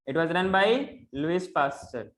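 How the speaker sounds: slow, stressing by, falling at the end; slow dictation. Shown as a demonstration. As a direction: dictate the answer clearly. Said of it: "dictating the answer".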